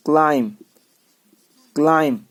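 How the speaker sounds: क्लाइम ending on a plain M; each क्लाइम separate, B left silent. Shown as a demonstration. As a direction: say क्लाइम with no B after the M. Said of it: "बी को silent करना है".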